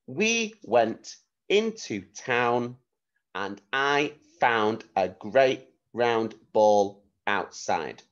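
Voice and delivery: slow and clear, word by word; slow careful dictation for young children. Shown as a demonstration. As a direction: say it slowly so pupils can write it down. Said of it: "I'm gonna say it a few times".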